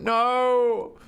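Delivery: high, drawn-out cry of protest; mock dismay. Player refusing the silly weapon, mock-dismayed cry. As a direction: wail the word in mock dismay.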